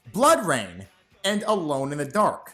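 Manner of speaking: ordinary talk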